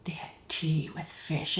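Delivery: hushed mock-horrified whisper; horrified shudder. Architect confiding his horror at tea with fish, hushed mock whisper. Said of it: "And he was shuddering".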